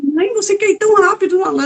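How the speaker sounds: high-pitched, incredulous question; retold surprise. Daughter questions mother's sudden eagerness, surprised. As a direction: act out being taken aback, voice lifted high.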